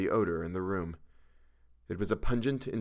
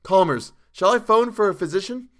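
high, loud anxious question; urgent concern; 11 semitones higher, 9 dB louder than usual. A narrator's reading. Narrator offering hysterical Chalmers a doctor, urgently. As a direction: break in on his raving with alarm.

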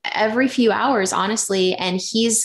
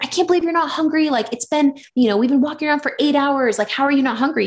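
higher and fast, worked-up disbelief; exasperated disbelief; 6 semitones higher than usual. Retold or imitated. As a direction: rant at him, half amused, half fed up. Retold quarrel with partner over hunger.

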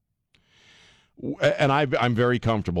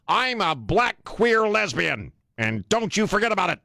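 mocking quote; 11 semitones higher than usual. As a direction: sneer it out like a proud slogan. Mocking CEO's proud declaration.

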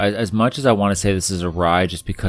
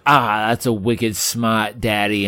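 slow imitated toddler Boston; drawn-out mock Boston "smaht"; Boston accent; slower than usual. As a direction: play a kid putting on Boston. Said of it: "in his Boston accent".

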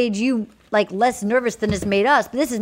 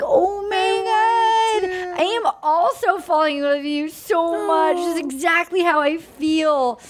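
mock gushing; 6 semitones higher than usual. Mocking gushing contestant reply.

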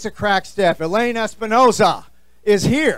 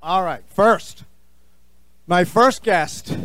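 ordinary delivery

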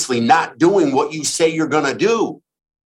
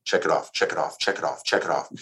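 quiet repeated mantra; 7 semitones lower, 7 dB quieter, faster than usual. Shown as a demonstration.

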